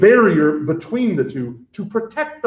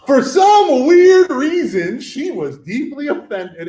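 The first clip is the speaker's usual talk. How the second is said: high, slow mock-dramatic voice; mock offended; 9 semitones higher, 4 dB louder, slower than usual. Lecturer mocking the girl's offense, high and slow. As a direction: play up the offense, high and drawn out.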